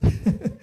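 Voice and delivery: a quick high burst of laughter. Real, not acted. Preacher laughing at his own example. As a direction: laugh at your own joke.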